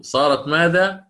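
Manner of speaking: ordinary talk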